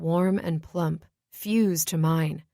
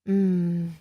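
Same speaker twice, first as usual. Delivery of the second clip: a quiet moan, eyes shut; soft moan. Acted. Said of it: "I moan".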